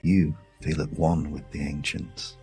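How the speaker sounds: usual speaking voice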